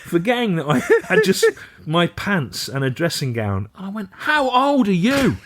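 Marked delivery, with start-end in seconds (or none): 4.1-5.4 high, slow, indignant retold demand; re-enacted angry outburst, drawn out. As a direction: demand it in exasperated disbelief, pitched way up. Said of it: "'Cause I was really angry".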